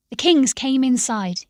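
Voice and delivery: ordinary delivery, nothing marked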